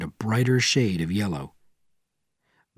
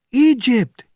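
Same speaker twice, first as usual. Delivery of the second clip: high, loud panicked scream; 14 semitones higher, 6 dB louder than usual. Acted. Officer with his ear to the telephone, screaming. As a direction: scream it into the phone. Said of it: "he screamed".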